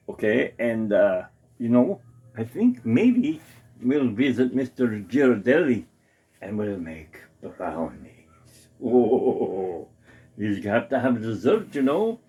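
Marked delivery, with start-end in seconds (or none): none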